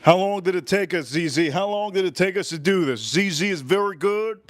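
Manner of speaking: usual speaking voice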